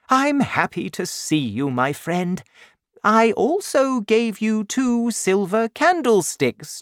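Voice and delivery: high-pitched, animated welcome; kindly bishop, delighted. Acted. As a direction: greet an old friend with generous surprise. Bishop pretends silver was a gift.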